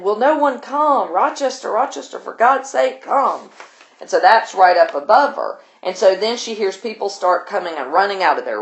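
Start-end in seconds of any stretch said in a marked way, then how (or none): 0.0-3.5 high-pitched, pleading mock calls; retold shouting. Struggling man calls Rochester for help, mock shouting. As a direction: imitate desperate calling without really yelling. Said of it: "and then a voice shouting".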